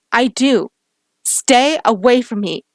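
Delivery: loud, slow final command; angry shout. Acted. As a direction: push him away with your voice. Ordering him away.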